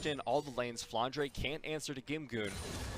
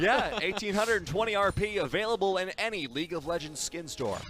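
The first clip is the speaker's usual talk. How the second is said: salesman pitch, higher and louder; infomercial voice; 6 semitones higher, 6 dB louder than usual. Pretend. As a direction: sell it like a TV ad. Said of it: "Is that a good salesman voice?".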